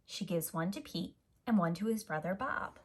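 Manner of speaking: plain reading voice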